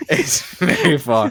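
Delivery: laughing, a little slow. Real, not acted. Guest laughing along with the host. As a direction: chuckle out the words.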